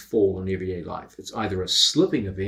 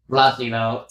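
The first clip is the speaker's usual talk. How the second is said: mock angry threat; 7 dB louder than usual. Pinned, threatening partner jokingly.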